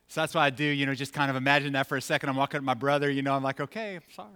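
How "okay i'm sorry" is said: put-on soft, hurried kid's apology; reluctant apology. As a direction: toss it away under your breath. Mumbled apology walking up to brother.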